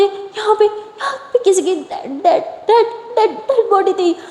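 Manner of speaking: high, shaky, stuttering and slow; can barely get the word out; nervous stutter with a nervous laugh; stammering fright. A narrator's reading. Lily stammers about the body.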